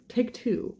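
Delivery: usual speaking voice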